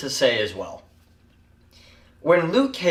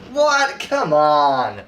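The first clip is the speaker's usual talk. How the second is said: mock incredulous Luke Cage; mock disbelief; 4 dB louder, much slower than usual. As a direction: imitate his incredulous line with a jump up. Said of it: "literally in this tone".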